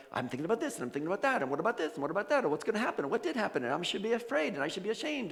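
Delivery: acted mental chatter, rising to a dismissive trail; racing thoughts. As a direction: rattle off nagging thoughts, pitch building. Acts out a cacophony of thoughts.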